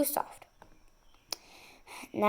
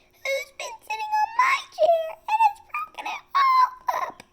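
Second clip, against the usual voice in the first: much higher, weepy little cub voice; crying baby bear. A narrator's reading. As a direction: whimper it like a tiny bear about to cry. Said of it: "Baby Bear began to cry".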